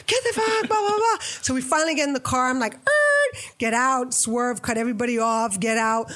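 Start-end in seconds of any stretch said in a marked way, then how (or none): 0.1-1.2 mock yell, much higher; a high, hammed-up panicked shout; retold yell. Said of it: "Fab's yelling at me". 2.8-3.4 panicked yelp. Retold or imitated. Fleeing gunfire, jumping into car.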